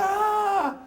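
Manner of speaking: high shout of pain from a ruler hit; pained yelp. Retold or imitated. Boy in hallway hit by ruler, yelps high=77. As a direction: yelp like you've just been struck. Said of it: "And then another".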